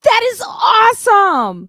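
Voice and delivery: thrilled yelp of joy; excited shout. Real, not acted. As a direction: gush with genuine excitement. Host thrilled by guest news.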